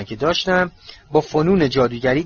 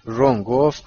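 ordinary delivery, nothing marked

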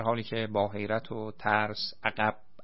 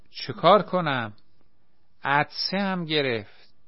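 helpless protest; 5 semitones higher, 4 dB louder than usual. A narrator's reading. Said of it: "گفت".

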